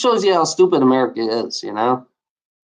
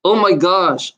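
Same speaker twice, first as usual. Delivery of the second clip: ordinary talk, nothing marked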